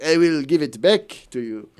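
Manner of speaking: slow, hammed-up French accent; mock French accent. Fake Brad Pitt promises to repay her, mock French accent. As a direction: make the French-accented promise sound over the top. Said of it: "the disgusting sounding French accent".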